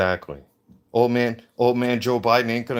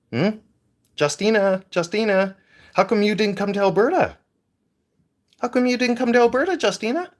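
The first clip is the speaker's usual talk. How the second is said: high mocking call, faster on the repeat; taunting sing-song; 11 semitones higher than usual. Mocking Trudeau for skipping the Stampede, high taunting sing-song. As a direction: taunt him like a playground bully.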